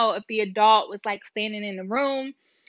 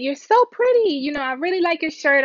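put-on higher, louder sweet voice for a small child; sweet talk to the lost little girl, much higher; talking to a toddler; 7 semitones higher, 4 dB louder than usual. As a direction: coo at a toddler to win her trust.

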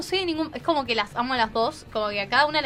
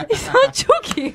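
higher, slow, laughing delivery; laughing punchline; 10 semitones higher, 5 dB louder, much slower than usual. Retold or imitated. Girl laughs as she repeats her brother calling her Chucky. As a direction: drag out the punchline while laughing.

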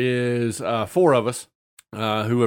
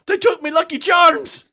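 much higher, louder wail through a laugh; mock anguished shout; 7 dB louder than usual. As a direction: cry out the loss like a tragedy, cracking up. Dwarf lord wails at party.

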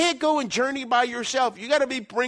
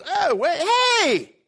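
much higher, louder bright hail; cheery teasing pastor; 8 semitones higher, 5 dB louder, slower than usual. Retold or imitated. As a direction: call out playfully across the hall. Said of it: "and he goes".

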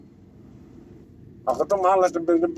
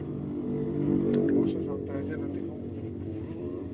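deep slow voice; 7 dB quieter, slower than usual. Pretend. Low drawn put-on voice.

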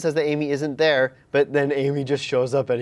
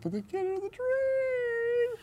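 mock dramatic; 7 dB quieter, much slower than usual.

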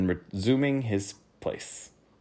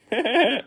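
high giggle; 6 dB louder than usual. Acted. Duke laughs after offer.

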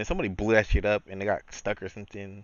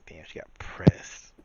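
whispered aside, slow; 2 semitones lower, slower than usual. Retold or imitated. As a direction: hush it slowly. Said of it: "I was like".

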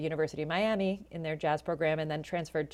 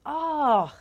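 retold discovery; 10 semitones higher, 5 dB louder than usual. Sudden realization about jazz.

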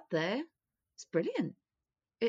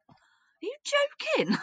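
a high, loud, shocked are you joking; incredulous; 6 dB louder than usual. Retold or imitated. Patient balking at the doctor, high shocked disbelief.

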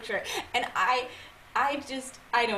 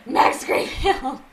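wistful laughing plea; 4 semitones higher, 9 dB louder, much slower than usual. Pretend. Imagined address to Max Greenfield.

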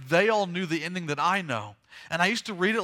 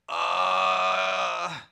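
long, drawn-out dumbfounded "uhhh"; stunned "uh"; 3 dB louder than usual. Acted. Crowd stares at Jesus, with a drawn-out stunned "uh". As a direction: hold a wordless noise of disbelief. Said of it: "they all look at him like".

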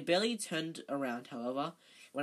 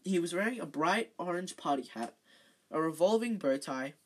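usual speaking voice

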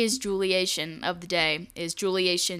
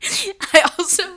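laughing; 5 semitones higher, 4 dB louder than usual. Real, not acted. Laughing at video call.

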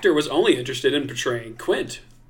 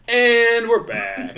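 mock show host; 9 semitones higher, 5 dB louder, much slower than usual.

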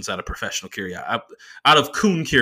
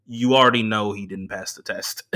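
normal talking voice

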